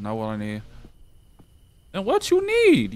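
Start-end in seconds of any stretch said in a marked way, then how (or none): 1.9-2.9 high, squeaky mocking of the game; lightly put-on squeak. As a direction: parrot the request in a silly high voice. Mocking the game's request.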